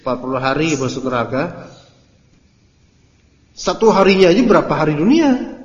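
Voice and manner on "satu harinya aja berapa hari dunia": higher, louder rising exasperation; indignant. Real, not acted. Ustadz challenging the boast, louder and higher. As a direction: demand it, incredulous.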